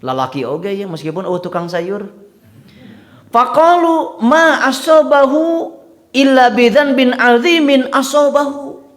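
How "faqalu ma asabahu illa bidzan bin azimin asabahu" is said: high, slow, louder gossiping voice; gossiping Israelites. Retold or imitated. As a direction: imitate the scornful gossips at Ayub's door. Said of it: "Kitu pekna teh". (suara tinggi dan lambat ala penggosip)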